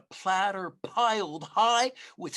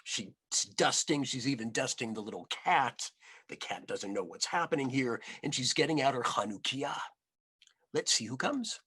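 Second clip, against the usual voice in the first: ordinary delivery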